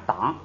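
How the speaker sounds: 党 said unaspirated, as a lazy spoken form of 堂; colloquial unaspirated. Shown as a demonstration. Teacher showing students the lazy spoken form, unaspirated. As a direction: say 党 with no puff of air, the easy casual way. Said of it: "读什么呀".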